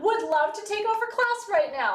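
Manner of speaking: single out the student mid-lecture; teacher calling out. Retold or imitated. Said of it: "I would stop and go".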